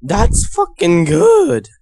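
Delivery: put-on high, slow drunk dude voice. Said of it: "You sound like a drunk dude".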